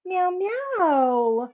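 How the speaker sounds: high, loud playful meowing; cat meow. Real, not acted.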